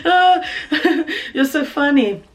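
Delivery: high, loud forced laughter; nervous fake laugh. Answering the manager's joke with a forced nervous laugh. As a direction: laugh it off nervously, hiding panic.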